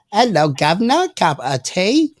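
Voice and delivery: put-on prim and proper British accent; mock British, prim and chirpy. Shown as a demonstration. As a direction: do an elegant, proper British greeting. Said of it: "the British accent's a little different".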